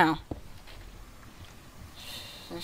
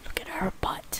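whispering; 12 dB quieter than usual. Real, not acted. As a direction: sly whisper. Whispered joke.

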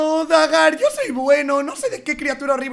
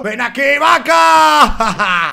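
loud, hammed-up predator yell; mock hunting shout; 7 dB louder, much slower than usual. Werewolf player shouting at a cow he hunts. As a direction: bellow at the cow like a beast.

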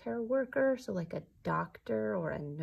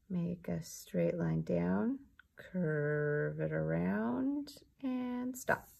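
slow step-by-step; much slower than usual. Shown as a demonstration. Teacher modelling the strokes.

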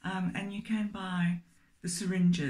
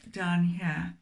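ordinary talk

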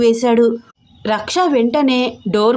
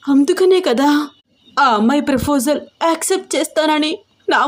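higher, hurt and close to tears; a young woman fighting back tears, pitched higher; hurt, near tears; 5 semitones higher than usual. Acted. Raksha at the window, hurt by Rocky, close to tears. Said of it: "ఏడుపు వచ్చేస్తోంది".

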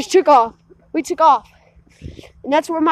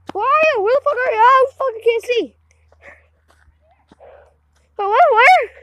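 high, raised searching call; calling out anxiously; 6 semitones higher than usual. Real, not acted. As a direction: shout to find someone unseen. Searching for friend in dark.

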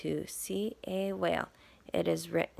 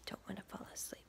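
whisper; 16 dB quieter, much faster than usual. Real, not acted. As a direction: whisper it like a secret. Aside while reading.

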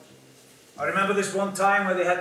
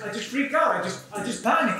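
ordinary delivery

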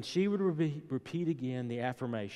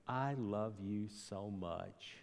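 put-on tender child; 3 semitones lower, 9 dB quieter, much slower than usual. Daughter's tender words retold.

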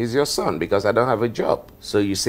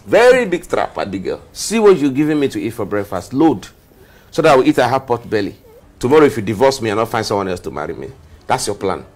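agitated accusation; 2 semitones higher, 6 dB louder than usual. Acted. Husband accuses his wife of a trap, loud and indignant. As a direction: accuse loudly, with rising alarm.

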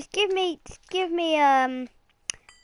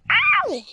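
high yelp of pain; sharp ow cry; 14 semitones higher, 3 dB louder than usual. Real, not acted. Child to dad, hit by a mob, sharp yelp. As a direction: yelp like you got hit. Hurt in game.

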